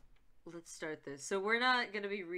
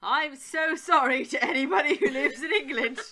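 high, loud, laughing through it; laughing apology; 5 semitones higher, 12 dB louder than usual. Real, not acted. Player apologising to England for the bad accent, laughing.

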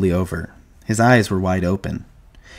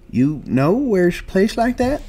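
put-on high, slow old-man voice; thin out into an old man, tentative; old Candy; 12 semitones higher than usual. A narrator's reading. Candy breaks into the two men's dream, high tentative old man.

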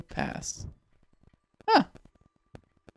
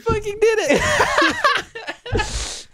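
high, drawn-out squeal through laughter; laughing triumph; 4 dB louder, much slower than usual. Real, not acted. Player celebrating the win with partner, laughing squeal. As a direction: slowly wail it out, helpless with laughter.

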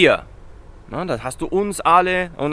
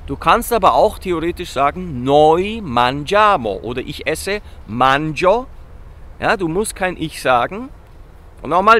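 ordinary delivery

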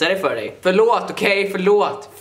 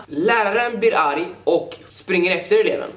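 ordinary talk, nothing marked

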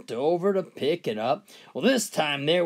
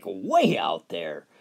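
usual speaking voice

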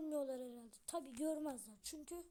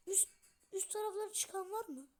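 high, louder mock-crying question; whiny cry; 5 semitones higher, 5 dB louder than usual. Real, not acted. Gamer stuck, asking viewers, whining. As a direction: whimper it like near tears.

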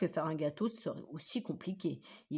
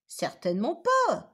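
higher, louder indignant denial; wolf protesting, loud and slow; 8 semitones higher, 8 dB louder than usual. A narrator's reading. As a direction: push back firmly. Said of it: "protesta Lou".

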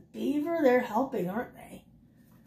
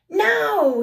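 much higher, louder shout; shouted command; 11 semitones higher, 5 dB louder than usual. Acted. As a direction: yell the signal to drop it. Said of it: "he shouted".

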